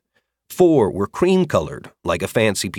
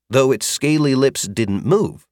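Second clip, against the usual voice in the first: ordinary delivery, nothing marked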